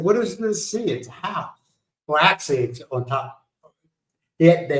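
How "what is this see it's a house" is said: incredulous retort. Retold or imitated. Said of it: "I said".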